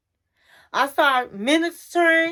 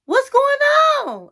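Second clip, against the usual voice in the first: pleading cry; 12 semitones higher, 6 dB louder than usual. Retold or imitated. Friends voiced urging.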